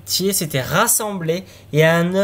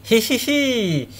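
gleeful giggle; 6 semitones higher, much slower than usual. Acted. Boy greets the coffin gleefully.

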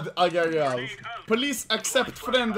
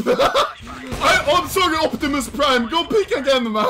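raised, loud voice full of laughter; high, hurried and amused; laughing excitement; 4 semitones higher, 5 dB louder than usual. Real, not acted. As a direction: blurt it out quickly while cracking up. Laughing apology to robotic teammate.

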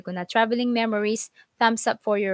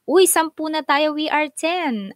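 glad to have ten watching; real joy; excited; 6 semitones higher than usual. Real, not acted. Ten viewers now on live.

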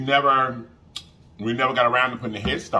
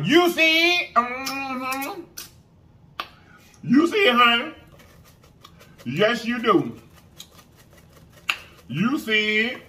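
coaxing a wild bird; 13 semitones higher, slower than usual. Pretend. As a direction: coo at it like a pet bird. Cooing at the chicken piece.

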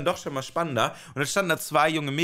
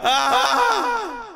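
short, loud, high yell of despair; frustrated scream; 12 semitones higher, 6 dB louder than usual. Retold or imitated. Trapped in the room with the receptionist, high despairing scream. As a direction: scream it out all at once.

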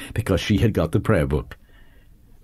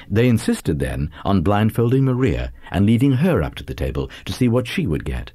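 ordinary delivery, nothing marked

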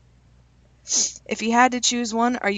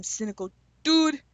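relishing the name; 4 semitones higher than usual. Shown as a demonstration. Admiring a YouTuber's name, savoring "Cynical Dude". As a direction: say "Cynical Dude" like you love how it sounds. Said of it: "I like this name".

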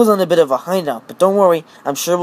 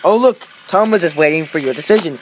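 usual speaking voice

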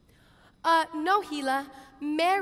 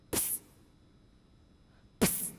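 hushed psst; 12 dB quieter than usual. Acted. Signalling sister quietly.

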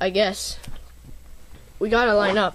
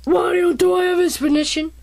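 ordinary talk, nothing marked

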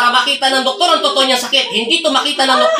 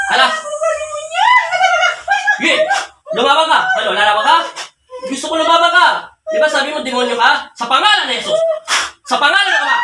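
challenging shout; 7 semitones higher than usual. Real, not acted. Exorcist challenges the demon.

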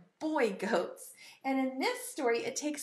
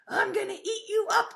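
raised-pitch menacing troll threat; mean troll; 8 semitones higher, 3 dB louder than usual. Acted. Troll threatening the little goat, mean voice. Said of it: "The troll didn't like that at all".

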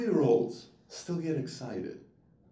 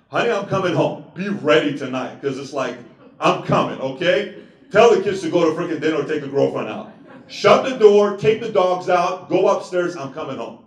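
louder, hammed-up call home to wife; mock husband call; 3 semitones higher, 9 dB louder than usual. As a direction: announce it like a big promise. Pastor calling wife home.

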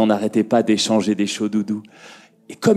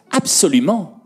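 high, gleeful-wicked witch voice; emphatic high witch confirmation; witch's emphatic reply; 9 semitones higher than usual. A narrator's reading. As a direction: pitch it high like a scheming witch. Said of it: "répondit Belzéfa".

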